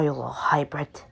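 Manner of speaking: soft teasing whisper; hushed menacing whisper. Real, not acted. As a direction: breathe it to the hybrid. Creeping up on game character.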